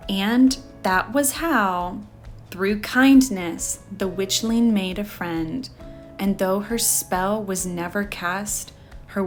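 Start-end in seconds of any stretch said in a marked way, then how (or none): none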